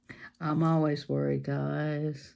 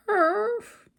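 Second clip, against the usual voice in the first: yappy bark, much higher and louder; dog bark; 4 dB louder than usual. Retold or imitated. Crafter imitating puppies barking for viewers, high yap. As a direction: yap like a little puppy.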